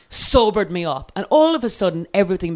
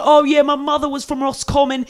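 higher-pitched mock London driver; London cabbie accent; 6 semitones higher than usual. London driver chatting about his mother, Cockney accent. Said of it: "one of these London taxi car drivers".

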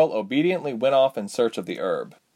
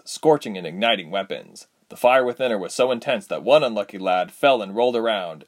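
ordinary delivery, nothing marked

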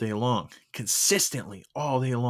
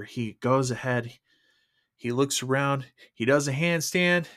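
usual speaking voice

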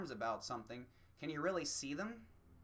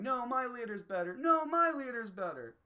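put-on higher, louder childish bickering; mock playground argument over leaders; squabbling partisans; 9 semitones higher, 7 dB louder than usual. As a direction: whine back and forth like kids. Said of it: "arguing amongst each other going".

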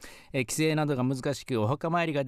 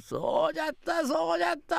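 laughing ghost; 11 semitones higher, faster than usual. Acted.

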